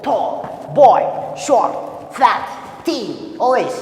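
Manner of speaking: each word called out loud and short; one syllable words called out. Shown as a demonstration. Teacher drilling class with short words, loud and clipped. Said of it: "we say it once".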